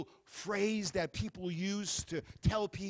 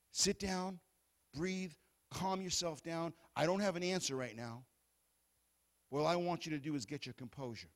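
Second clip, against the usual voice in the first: soothing calm; 5 semitones lower, 7 dB quieter than usual. Pretend. Calming an overwrought person.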